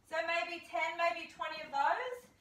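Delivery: usual speaking voice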